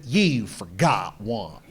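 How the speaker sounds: low, slow croaky granny voice; a ninety-year-old woman scolding, slow and low. Retold or imitated. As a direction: speak like an angry old lady. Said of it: "She remember you said cougar".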